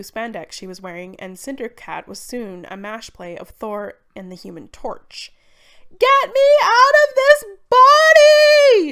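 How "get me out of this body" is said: put-on high, slow toddler wail; caterwauling. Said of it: "she caterwauled".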